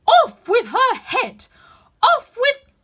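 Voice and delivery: furious high shriek, drawn out; screamed. A narrator's reading. The Queen screaming at Alice like a wild beast. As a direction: scream the order slowly at the top of your voice.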